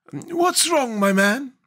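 very high, untroubled character voice; calm Scarecrow. A narrator's reading. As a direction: ask mildly in a high put-on voice. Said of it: "said the Scarecrow calmly".

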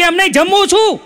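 annoyed uncle shouting down the phone. Retold or imitated. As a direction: raise the pitch and press, fed up of repeating. Uncle insists third time, louder. (ચિડાઈને ઊંચા અવાજે)

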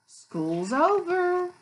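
high, loud and drawn out; storybook call-out. A narrator's reading. Teacher reads the end of the school day, drawn-out call. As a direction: sing out the announcement slowly. Reading picture book aloud.

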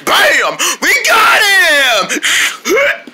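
drawn-out high victory yell; triumphant shout. Acted. Triumph at retrieving the ball.

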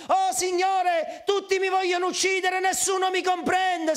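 dramatic high-pitched wail of complaint; Elia's complaint. Acted. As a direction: cry out like a persecuted prophet. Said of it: "Fai come Elia".

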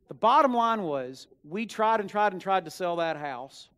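preaching with force. Acted. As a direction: drive the line home to the congregation.

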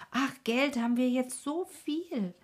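high, loud triumphant exclamation. A narrator's reading. Stineli boasting to Rico about money, triumphant. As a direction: crow it out in triumph. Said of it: "rief Stineli triumphierend".

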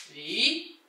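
ordinary talk

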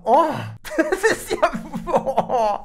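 breaking into laughter mid-sentence; laughing. Real, not acted. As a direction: laugh through the words. Laughing at awkward scene.

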